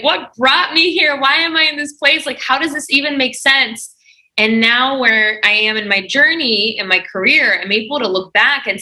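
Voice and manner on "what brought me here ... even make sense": higher-pitched, bewildered self-questioning; confused. Retold or imitated. Guest recalling her doubts about where she worked, confused. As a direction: voice your own past confusion, pitched up.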